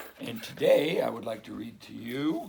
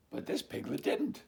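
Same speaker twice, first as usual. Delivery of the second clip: ordinary delivery, nothing marked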